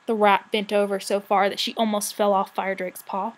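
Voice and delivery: usual speaking voice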